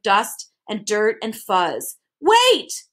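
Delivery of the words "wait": high, loud call; urgent high-pitched shout. A narrator's reading. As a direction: shout after someone leaving. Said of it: "shouted Milo".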